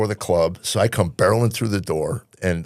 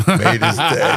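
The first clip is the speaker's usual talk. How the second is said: higher, louder, delighted echo; excited repeat; 6 semitones higher, 4 dB louder, slower than usual. Shown as a demonstration.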